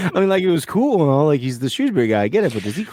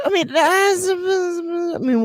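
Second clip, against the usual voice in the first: mock crying; much slower than usual. Fake crying mid-compliment.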